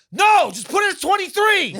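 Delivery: high, loud shouted order; yelling at coworker. Retold or imitated.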